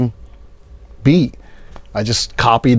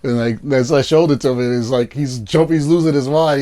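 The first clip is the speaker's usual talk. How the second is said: ordinary talk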